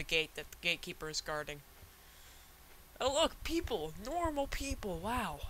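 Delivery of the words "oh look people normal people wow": excited. Real, not acted. Finds people after wandering lost.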